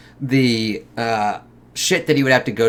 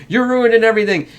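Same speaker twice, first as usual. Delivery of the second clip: mock scolding; 9 semitones higher, 3 dB louder, faster than usual. Acting out family blaming Kevin.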